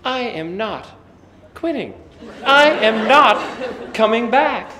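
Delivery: high, loud, slow and laughing defiance; defiant declaration. Retold or imitated. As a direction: plant your feet and refuse to quit. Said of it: "I said".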